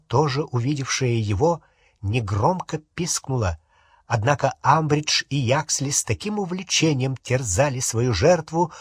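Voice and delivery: usual speaking voice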